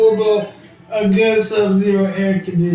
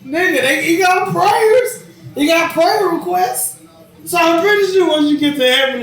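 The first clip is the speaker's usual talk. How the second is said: excited insistence; 9 semitones higher than usual. Real, not acted. Insisting in heated banter.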